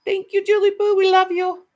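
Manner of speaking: high, loud, delighted thanks to a beloved viewer; high gushing thanks. Real, not acted. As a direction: gush your thanks to a dear friend.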